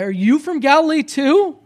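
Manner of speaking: loud, high mock-incredulous question; incredulous retort. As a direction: fire it back as a scornful challenge, pitch climbing. Pharisees' acted retort to Nicodemus.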